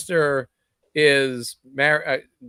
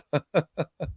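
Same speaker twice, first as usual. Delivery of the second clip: short laugh; 4 semitones higher, 5 dB quieter than usual. Real, not acted. Laughs at own memory joke.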